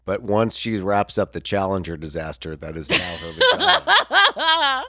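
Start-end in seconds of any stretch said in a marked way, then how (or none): 2.6-4.8 much higher, slow laughing squeak; high laughing squeak at own joke; laughing squeal. Real, not acted. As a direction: squeal the punchline through a laugh.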